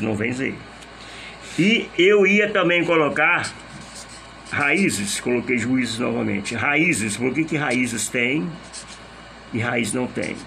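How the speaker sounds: usual speaking voice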